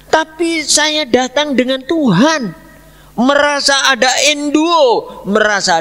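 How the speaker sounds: high, slow, loud proclamation; fervent preaching. Acted. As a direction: lift it up like a testimony from the pulpit. Preaching with full force. (khotbah berapi-api, tinggi dan lambat)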